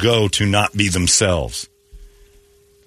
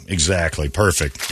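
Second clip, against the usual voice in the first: ordinary talk, nothing marked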